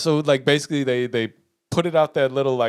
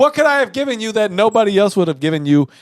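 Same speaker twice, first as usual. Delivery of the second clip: higher, louder incredulous comeback; indignant protest; 6 semitones higher, 5 dB louder than usual. Retold or imitated. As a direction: protest your innocence, raised voice. Indignant retort in retold argument.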